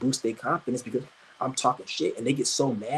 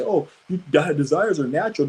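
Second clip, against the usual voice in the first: mocking quote; 4 semitones higher, 4 dB louder than usual. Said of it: "these dumbass people out here say".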